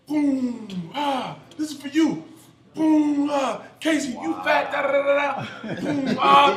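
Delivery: high, loud grunted reps with taunts; yelling through lifts. Retold or imitated. Ex-player acting a teammate lifting at Casey Hampton, mock furious yelling. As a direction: holler at a rival between explosive reps. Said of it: "starts yelling".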